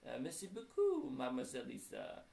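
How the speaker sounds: put-on playful French accent, starting high and loud; hammed-up French accent. A narrator's reading. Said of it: "If you say anything in a French accent".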